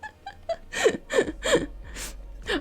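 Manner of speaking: breathless high-pitched laugh; squeaky silent laugh; high squealing laugh. Real, not acted. Chat finds it hilarious.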